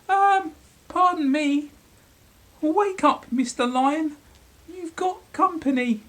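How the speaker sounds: very high, squeaky polite mouse; tiny mouse waking the lion; timid mouse voice. Acted.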